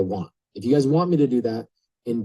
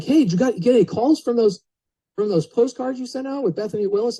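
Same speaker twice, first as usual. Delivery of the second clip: wife's voice pitched much higher; imitating wife; 11 semitones higher than usual. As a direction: mimic the wife's eager high question. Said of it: "she's like".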